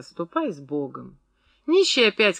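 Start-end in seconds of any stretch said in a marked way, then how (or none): 0.0-1.2 low, subdued parting words; brief dismissal. A narrator's reading. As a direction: send them off softly and briefly. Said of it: "произносила всегда кратко".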